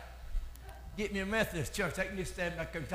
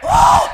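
loud, very high whoop; ecstatic whoop; 23 dB louder than usual. Real, not acted. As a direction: let out a high revival whoop. Whoops at sermon's peak.

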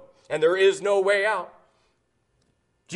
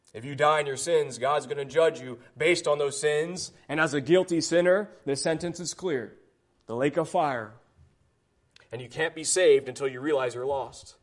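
lower, softer, grave warning; preacher going quiet to press home judgment; solemn hush; 6 semitones lower, 6 dB quieter than usual. Acted. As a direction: drop the voice to sober them. Drops low and soft for gravity.

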